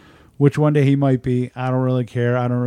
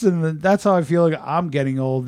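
normal talking voice

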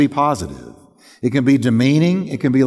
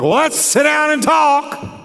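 much higher, shouted "Well, let's sit down and talk"; 10 semitones higher than usual. Shown as a demonstration. Pastor showing spouses the wrong volume, shouted. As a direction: yell the invitation angrily. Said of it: "the volume you use".

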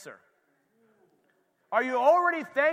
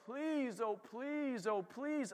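mock begging; 9 dB quieter, much slower than usual. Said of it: "So if you're just begging".

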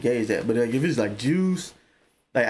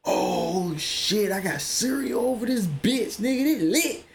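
excited quote; 7 semitones higher, slower than usual. Retold or imitated. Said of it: "I was like".

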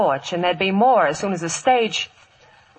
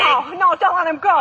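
desperate plea; 9 semitones higher, 3 dB louder than usual. Acted. Lola pleading, wounded.

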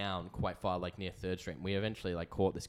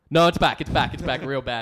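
loud panicked yell, much higher; desperate yell; 5 semitones higher, 15 dB louder than usual. Retold or imitated. In the cab to his friend, a loud panicked yell. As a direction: shout it like the need just came roaring back.

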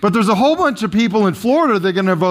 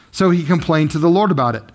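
normal talking voice

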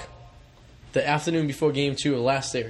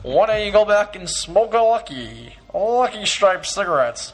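slow, louder, hammed-up old commercial voice; vintage ad announcer; 3 semitones higher, 4 dB louder, slower than usual. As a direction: sell it like a fifties cigarette pitchman. Mock old cigarette ad.

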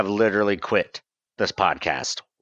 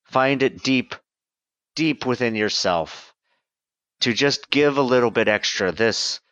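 mock earnest appeal. Host pleading with listeners, mock solemn. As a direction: plead like a charity ad.

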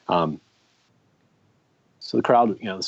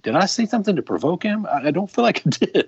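high-pitched imitation of a baffled buddy; mock innocence; 11 semitones higher, 3 dB louder than usual. Friend imitating his buddy asking if he provoked him, mock innocence. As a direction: put on a higher, innocent protesting voice with a laugh creeping in.

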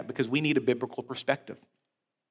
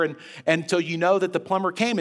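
ordinary talk, nothing marked

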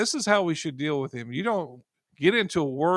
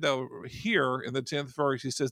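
ordinary delivery

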